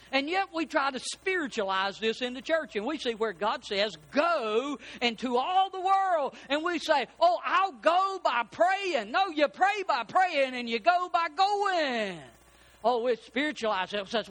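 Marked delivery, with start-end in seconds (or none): 4.1-12.2 higher, slow, drawn-out pulpit proclamation; preaching. Real, not acted. Preacher rebukes church excuses for not going, preaching. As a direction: stretch the key words as you preach.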